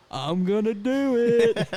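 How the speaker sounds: loud, high, drawn-out threat; mock drunken defiance. Drunk guitarist on the phone to his roommate, defiant. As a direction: stretch it out like a stubborn drunk.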